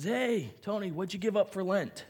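lightly voiced chirpy friend; high school friend. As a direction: ask it like a curious schoolmate. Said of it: "they'd look at me and says".

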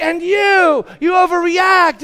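high, loud re-enacted scolding; angry accusing shout. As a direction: shout the blame at the other kid. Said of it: "But now I'm mad now".

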